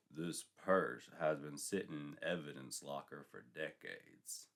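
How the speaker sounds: a weary officer stating evidence; low stern sergeant. Acted. Sergeant shows Sandy her purse, low and slow.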